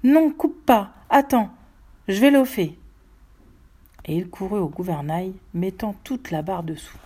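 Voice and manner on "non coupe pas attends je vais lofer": cri angoissé, haut et fort; cri torturé. A narrator's reading. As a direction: crie pour les arrêter, le cœur torturé. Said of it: "Il cria le cœur torturé". (cri déchiré, suppliant d'attendre)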